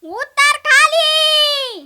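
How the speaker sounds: loud, high, very slow order; commanding shout. Retold or imitated. Daughter-in-law ordering guest down, loud slow shout. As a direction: bark the order slowly. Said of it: "मोठी सून म्हणाली".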